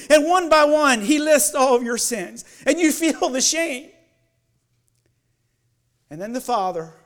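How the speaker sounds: loud, intense preaching; fervent preaching. Acted. Pastor describing the Son's sacrifice, fervent loud preaching. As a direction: drive the vision home. Continuing fervent sermon vision.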